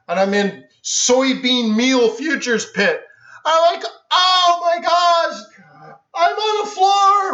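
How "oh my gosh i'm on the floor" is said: high, slow and drawn out in wonder; breathless retold amazement at the trading floor; awestruck. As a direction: stretch it like you can't believe your eyes. Awestruck entering the trading pit.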